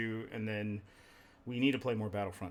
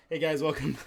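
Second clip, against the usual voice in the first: host greeting; 5 semitones higher, 7 dB louder than usual. Acted. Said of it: "I feel like such an idiot having to re-say this".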